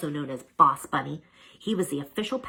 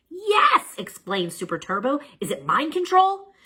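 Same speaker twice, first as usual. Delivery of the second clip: excited; 11 semitones higher, 6 dB louder than usual. A narrator's reading. Excited guess.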